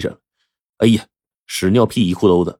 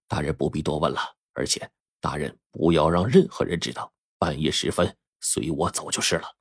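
soft, hushed urging; low voice; 4 semitones lower, 7 dB quieter than usual. A narrator's reading. Secret visitor urges Wang Liqing, hushed and low. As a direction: keep it down, urge him quietly. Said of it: "这才低声说".

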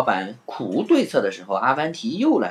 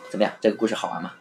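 plain reading voice

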